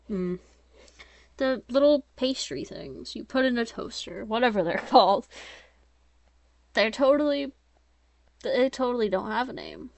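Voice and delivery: ordinary delivery, nothing marked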